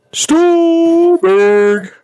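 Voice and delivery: big high announcer call; announcer hype. Pretend. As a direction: call out the name like a ring announcer. Hype intro of guest.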